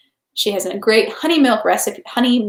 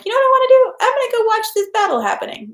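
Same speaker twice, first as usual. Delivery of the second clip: mock eager; 13 semitones higher, faster than usual. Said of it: "no one's like".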